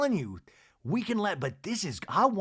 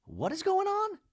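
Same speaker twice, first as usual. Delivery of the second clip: high confused surprise. Retold or imitated. Mary Cosby interrupted at her soup, shrilly baffled. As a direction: pitch jumps way up in bewilderment. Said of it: "She's like".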